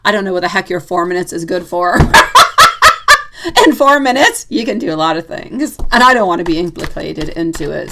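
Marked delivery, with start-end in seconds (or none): 2.0-3.6 laugh. Real, not acted. Laughing at the caller's odd request.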